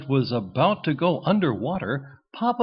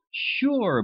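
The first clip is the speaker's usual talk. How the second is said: eager; 10 semitones higher than usual. A narrator's reading. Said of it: "Buzzy said".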